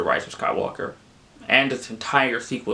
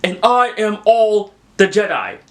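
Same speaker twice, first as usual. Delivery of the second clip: mock heroic Rey; 7 semitones higher, 5 dB louder than usual. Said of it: "then Rey just says".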